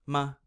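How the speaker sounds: "Ma" quick, without any tone; light toneless "Ma"; neutral tone. Shown as a demonstration. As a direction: toss off "Ma" short and unstressed. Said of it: "kind of just, like, a quick".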